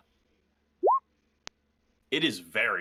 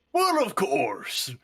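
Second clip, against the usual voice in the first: high, slow, hearty booming reply; jolly dwarf; 11 semitones higher, 7 dB louder, much slower than usual. Acted. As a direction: boom it out, slow and delighted, like a proud shopkeeper. Dwarf shopkeeper answers grandly.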